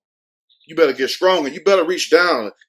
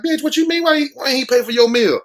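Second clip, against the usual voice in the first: mock telling off; 7 semitones higher than usual. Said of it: "I would have been like".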